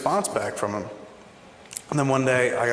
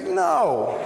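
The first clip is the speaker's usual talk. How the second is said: mock refusal. Prince flatly refusing song.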